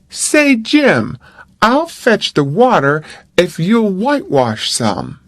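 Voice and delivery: lightly voiced boy asking a favour; coaxing boy. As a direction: wheedle like a boy dodging chores. Tom coaxing Jim.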